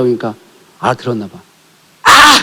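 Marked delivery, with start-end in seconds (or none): 2.0-2.4 mock child cry. Child's cry in retold story.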